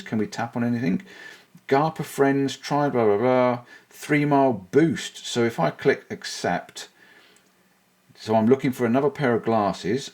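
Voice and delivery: ordinary talk, nothing marked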